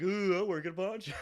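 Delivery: high, loud sneering imitation; mocking taunt. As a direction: jeer at him like a childish drunk. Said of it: "starts doing, like, the, the finger of the nose".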